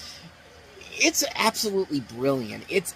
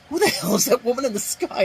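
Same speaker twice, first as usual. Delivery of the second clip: high, louder mock jock voice; mock bully yell; 10 semitones higher, 5 dB louder than usual. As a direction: bark it like the jerk jock, high and loud. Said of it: "look up in the sky and go".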